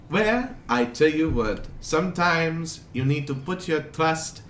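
put-on muddled European accent dispensing advice; wandering foreign accent. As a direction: sage stranger with an unplaceable accent. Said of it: "his accent keeps jumping around a lot".

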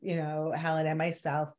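ordinary delivery

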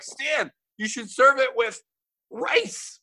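high excited mom voice. Retold or imitated. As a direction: squeal it like a proud mom. Imitating mom's excitement.

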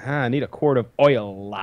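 oil stretched out with a showy final L; imitated dad over-pronouncing oil; fully enunciated oil. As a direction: say oil with every sound, as a demonstration. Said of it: "fully enunciate it and make it clear".

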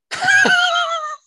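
loud burst of laughter; big laugh. Real, not acted. Joke punchline laugh.

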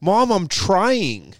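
slow, whiny, high pleading; whiny daughter. Retold or imitated. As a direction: drag it out like a sulky kid. Mocking daughter whining.

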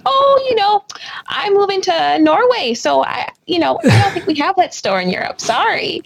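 a high, sweet, mock-apologetic shop-counter voice; apologetic excuse. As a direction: fake a polite excuse at the checkout, pitched high. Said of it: "I was like".

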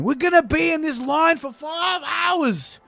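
high, slow wailing shout; mock Australian whinge; whining Australian kid. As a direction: wail it like a bored Aussie boy. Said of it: "And he says again".

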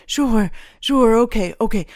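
distressed, hurried surrender; pleading. A narrator's reading. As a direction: plead as if before judges. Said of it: "Stahl pleaded as if with judges, his voice weak".

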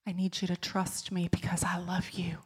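soft unvoiced whisper of God's voice. Preacher recalling God whispering to her in the car, whispered. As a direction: breathe it, gentle and intimate. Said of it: "beautiful whisper of the Lord's voice".

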